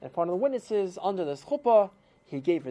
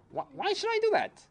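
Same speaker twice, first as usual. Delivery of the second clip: incredulous. Retold or imitated. Matul refuses the divorce.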